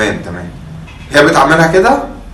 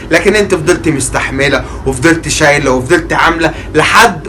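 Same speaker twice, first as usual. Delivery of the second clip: sincere vow; 8 semitones lower, 5 dB quieter than usual. Acted. Husband vowing to serve mother.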